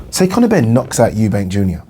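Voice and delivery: ordinary talk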